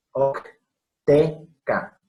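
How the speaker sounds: "Octeca" lento, marcando la c dura antes de la t; Octeca bien dicho. Shown as a demonstration. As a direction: say "Octeca" slowly with a hard k before the t. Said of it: "no och, ni zoe, ni no". ("Octeca" despacio, con k clara)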